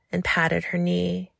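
plain reading voice

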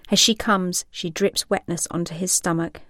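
usual speaking voice

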